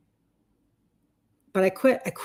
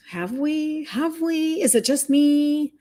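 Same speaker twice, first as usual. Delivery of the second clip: rhetorical plea; 6 semitones higher, slower than usual. Real, not acted.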